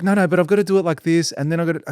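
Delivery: high, whiny mock voice of the assistant; mocking the assistant's protest in a high whine; mimicking her. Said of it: "And she's like".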